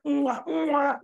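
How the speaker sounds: two exaggerated smooches; mock kissing sounds. Lover saying good night to sweetheart, playful kiss noises.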